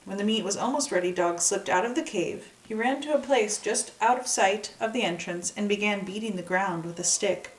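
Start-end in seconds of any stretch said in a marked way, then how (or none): none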